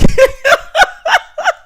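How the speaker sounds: high, loud burst of real laughter; cracking up hard; big laugh. Real, not acted. Laughing at the bouncing car.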